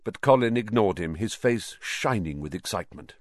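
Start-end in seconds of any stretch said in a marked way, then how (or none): none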